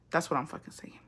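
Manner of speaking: breathy whispered aside; soft unvoiced muttered agreement. Real, not acted. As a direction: whisper it to yourself, exasperated.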